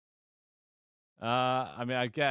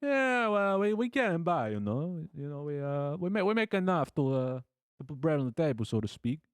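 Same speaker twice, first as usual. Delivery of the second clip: hammed-up Italian-American, pitched high then settling; New York Italian accent; 5 semitones higher than usual. Blacksmith saying they get by, clumsy New York Italian accent. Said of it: "New York Italian".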